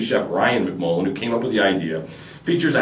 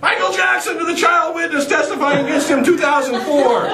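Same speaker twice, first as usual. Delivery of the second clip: much higher, laughing eager shout; shouted punchline. Acted. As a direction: blurt it out like a keen schoolboy.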